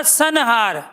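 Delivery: सनहार said very slowly, syllable by syllable; slow demo word. Shown as a demonstration. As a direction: stretch out सनहार, न clearly heard. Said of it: "अब ये अनुस्वार ही बताएंगे कि किस में क्या है". (सनहार को धीरे-धीरे बोलकर दिखाना)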